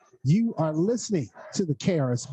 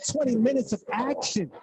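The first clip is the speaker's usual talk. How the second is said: higher, rising buzzer-beater call; excited call; 3 semitones higher than usual. Real, not acted. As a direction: lift the voice for the last shot. Buzzer shot call at halftime.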